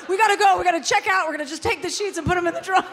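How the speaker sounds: wife imitating husband rushing to check out; mimicked panic. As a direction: rattle it off like a man desperate to flee. Husband's panicked plan, retold.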